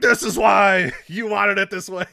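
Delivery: hammed-up shouted villain promo, high and loud; slow, shouted heel promo rant; Ciampa promo voice. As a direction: yell it like a wrestler pressed against the cage. Acting Ciampa's cage-face promo.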